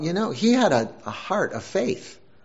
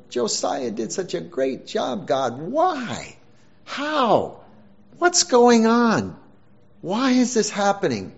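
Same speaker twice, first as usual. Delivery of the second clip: higher, anguished questions to God; perplexed pleading; 6 semitones higher than usual. Retold or imitated. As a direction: plead with God, baffled and pained.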